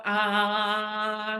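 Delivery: long voiced "ah" sigh; drawn-out vocal exhale, held steady; audible release exhale. Shown as a demonstration. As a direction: let tension out on a sustained open "aah". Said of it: "with an audible sound".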